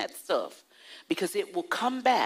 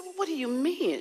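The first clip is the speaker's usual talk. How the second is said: a touch of hurt surprise, higher; mildly offended; 9 semitones higher, faster than usual. Retold or imitated. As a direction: ask back, lightly put out. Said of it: "I was a little bit offended".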